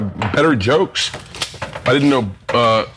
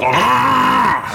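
short squealed "Ah!" well above the voice; high mock shriek; 10 semitones higher than usual. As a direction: yelp it high. Voicing Anne Frank's shriek.

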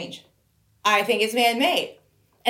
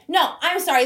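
ordinary delivery

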